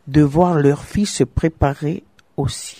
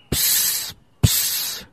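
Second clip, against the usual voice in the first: soft breathy psst hisses from the snake; 7 dB quieter than usual. Acted. The serpent hissing softly at the young wife. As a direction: hiss each psst like a serpent. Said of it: "siffla le serpent".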